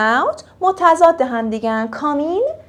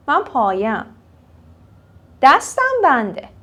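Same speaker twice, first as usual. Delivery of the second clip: ordinary talk, nothing marked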